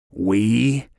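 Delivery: sneering, incredulous echo. A narrator's reading. Vicus mocking Walker's claim, sneering question. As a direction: throw the word back with scorn. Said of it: "Vicus sneered".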